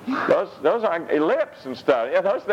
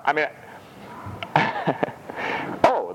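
ordinary delivery

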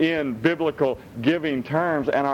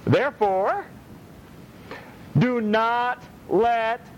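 forceful preaching; 9 semitones higher than usual. Acted. Preacher drives the verse home to the congregation, forceful. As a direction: lean on each phrase like a preacher.

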